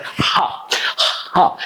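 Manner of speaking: ha ha breathed from the lungs; breathy h demo. Shown as a demonstration. Teacher showing the h sound. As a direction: push each ha out from the belly. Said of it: "And you speak up like".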